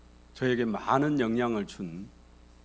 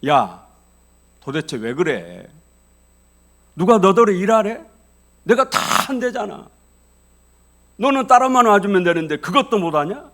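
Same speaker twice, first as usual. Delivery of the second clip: loud, furious outburst at his wife; angry husband yelling; 9 semitones higher, 12 dB louder than usual. Retold or imitated. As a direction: bark at her like a fed-up husband. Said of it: "남편이 화를 버럭 내면서". (화가 나서 아내에게 버럭 소리치는 남편)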